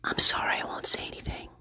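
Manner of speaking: soft unvoiced mock whisper; imitating a whisper. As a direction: whisper it as if to someone unseen. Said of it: "responding to another voice".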